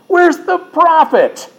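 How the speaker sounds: very high, drawn-out rhetorical question; high challenging cry. Acted. Cries out the verse's challenging question.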